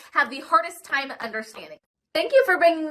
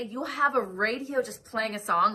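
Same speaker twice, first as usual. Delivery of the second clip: usual speaking voice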